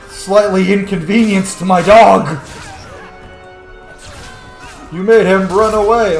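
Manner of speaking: mock-outraged shout. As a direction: yell at foes who hurt your dog. Scolding enemies for hurting dog.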